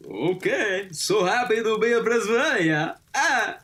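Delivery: put-on high-pitched Prasvalian accent; made-up Prasvalian accent. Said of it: "what that accent was".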